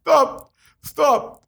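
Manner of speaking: squeaky, breathless stop; high laughing plea. Real, not acted. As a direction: beg to stop, cracking up. Still laughing.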